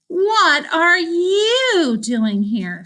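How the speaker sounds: high, slow gasping question; stunned disbelief; shocked. A narrator's reading. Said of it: "Sasha gaped".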